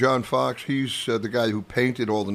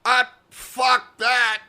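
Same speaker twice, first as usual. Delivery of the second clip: high, loud, drawn-out curse; acted fury; 11 semitones higher, 5 dB louder, much slower than usual. Club boss driving up to the painted wall, in acted fury. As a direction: imitate the boss cursing slowly at full voice.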